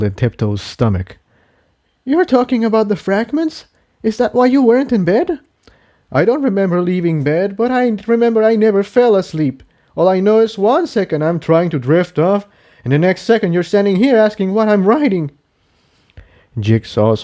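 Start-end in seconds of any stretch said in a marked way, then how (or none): 2.0-5.4 much higher, quick anxious questions; higher filly voice, alarmed; Tiptoe, worried. Acted. 6.1-15.3 higher and fast, unsettled; higher colt voice, rattled; Jigsaw, shaken. Acted. Jigsaw explains blackout.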